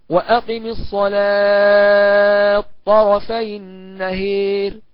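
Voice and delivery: slow chanted tajweed recitation; Quranic recitation. Shown as a demonstration. Teacher reciting the verse for students, slow and chanted. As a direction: draw out the verse in melodic recitation.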